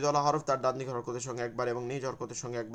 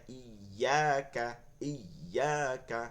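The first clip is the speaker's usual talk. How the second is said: slow joined reading; much slower than usual. Shown as a demonstration. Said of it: "কাফ জবর কা".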